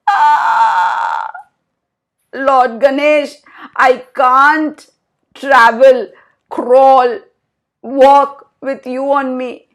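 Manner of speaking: high squeaky little mouse voice; a strained mouse under a heavy load. Acted. Mouse squeaking to Ganesha that he is too heavy, high and squeaky. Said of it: "the mouse squeaked".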